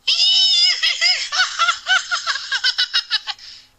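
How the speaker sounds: high shrieking giggle; high shrieking laugh. Acted. Reader acting out Amy being tickled, shrieking laugh. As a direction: squeal with laughter like a tickled girl.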